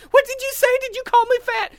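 sharp hurt outrage; offended woman voice. Retold or imitated.

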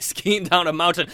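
normal talking voice

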